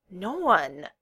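plain reading voice

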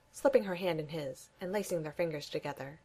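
plain reading voice

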